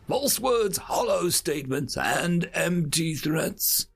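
put-on slow, high, grave old storyteller; solemn elder storyteller. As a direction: tell it like an ancient legend. Elder tells the old tale.

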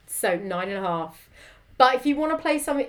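ordinary talk, nothing marked

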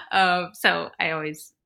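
usual speaking voice

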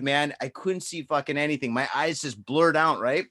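usual speaking voice

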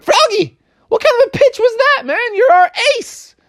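very high, whining complaint; dismayed teammates. Acted. Teammates complaining to Froggy, very high and dismayed. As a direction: complain about the pitch. Teammates upset at bad pitch.

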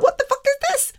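very high, disbelieving shriek; shocked squeal. Retold or imitated. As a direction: squeak it out in horror. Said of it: "I was like".

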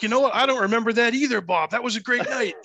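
high, loud buddy impression; imitating Sal. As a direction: play up a cheerful friend. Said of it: "He's like".

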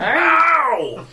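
high, drawn-out "ow"; yelp of pain. Acted. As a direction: cry out as if hurt. Sudden cry in acted fight.

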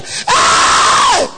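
imitating the man's long shouted "Hey!"; sudden drawn-out holler; startled shout. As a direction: shout it out as the alarmed gunman. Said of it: "The man shouted".

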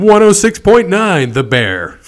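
loud, high, drawn-out station ID; slow, booming call-sign delivery; radio announcer voice. Acted. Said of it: "Yeah, he's like".

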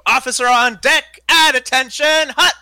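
loud, high barked military command; drill sergeant. Acted. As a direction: bark it like a drill sergeant on deck. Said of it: "I slip into the cadence of, like, a drill sergeant".

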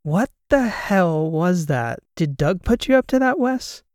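baffled, high-pitched accusation; incredulous. Acted. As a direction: demand an explanation, pitch soaring. Demands explanation after bizarre call.

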